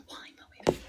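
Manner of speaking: very soft whispered self-question. Real, not acted. Streamer wondering to herself about waving, whispered. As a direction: whisper it under your breath. Repeats own question under breath.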